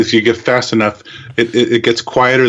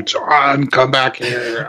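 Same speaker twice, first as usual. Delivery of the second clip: slow, drawn-out call from far off; calling after; 2 semitones higher, much slower than usual. Pretend. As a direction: call him back slowly, fading. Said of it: "calling after you".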